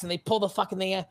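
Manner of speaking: usual speaking voice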